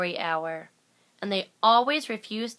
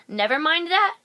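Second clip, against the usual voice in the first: loud, higher curt snap; snapped order; 8 semitones higher, 7 dB louder than usual. A narrator's reading. The strict teacher cutting off the kids' question, snapping loudly. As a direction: bite the words off sharply. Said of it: "snapped Miss Swamp".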